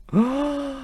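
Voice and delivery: high, shocked intake of breath; mock gasp. Radio host told by a texter he is not correct, mock gasp. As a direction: gasp as if scandalised.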